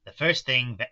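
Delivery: plain reading voice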